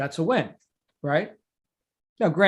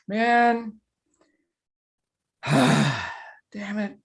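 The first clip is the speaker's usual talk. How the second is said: frustrated groan; 3 semitones higher, much slower than usual. Retold or imitated. Coach re-enacting his look at project statistics, frustrated. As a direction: let out an exasperated sigh, acting out the letdown. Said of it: "why am I getting all emotional about that".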